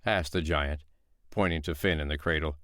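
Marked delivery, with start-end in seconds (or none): none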